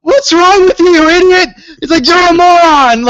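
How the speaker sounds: high, loud mock yell at the ref; imitating Triple H yelling. Imitating Triple H yelling insults at the referee, high and loud. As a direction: bark insults like an enraged boss. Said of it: "Triple H to the ref was like".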